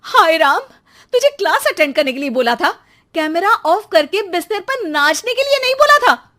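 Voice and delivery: loud, high, fast outraged scolding; mother catching her son dancing in class, shouting in exasperation; exasperated scolding. Acted. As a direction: let the outrage burst out.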